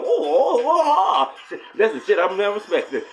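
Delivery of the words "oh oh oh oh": high, loud mock moaning with laughter; imitating Mister Sulu's moans. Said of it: "he be like".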